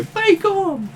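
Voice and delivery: very high squeaky creature cry; creature squeak. Acted. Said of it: "you see Eep go".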